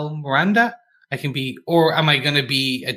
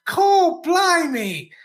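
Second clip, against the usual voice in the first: badly faked Australian accent, pitched way up; much slower than usual. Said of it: "Why not go down under?".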